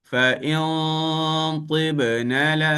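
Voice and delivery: Fa in tibna la very slow and half sung; thick slow reading. Shown as a demonstration. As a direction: chant Fa in tibna la heavily. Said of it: "dengan tebal". (bacaan tebal)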